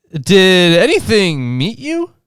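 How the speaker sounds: slow, high-pitched mock old lady. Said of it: "can't exactly do the old lady voice".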